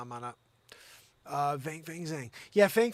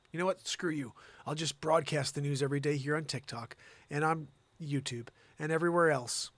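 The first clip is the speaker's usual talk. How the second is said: normal talking voice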